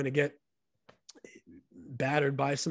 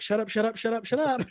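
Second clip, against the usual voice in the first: higher, laughing "shut up" to his buddy; mock impatient hush; 8 semitones higher than usual. Narrator in the sauna, hushing his chatty buddy with a laugh. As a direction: wave off the chatter while laughing, desperate to keep the words. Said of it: "And I'm like".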